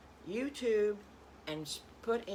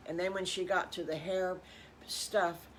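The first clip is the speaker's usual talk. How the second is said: ordinary delivery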